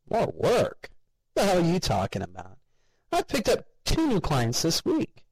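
high, nosy coworker voice; nasal gossip voice. A narrator's reading. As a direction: pitch it up like a gossiping slob. Ted voiced, far higher.

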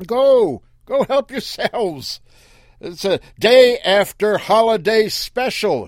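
loud, high sales-pitch call. Acted. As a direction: hawk it like a salesman. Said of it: "he called across the clear".

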